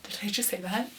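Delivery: quietly stunned, re-enacted; hushed, dazed retold reaction; stunned disbelief. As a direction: say it softly, as if barely believing the news. Stunned reaction to winning the title.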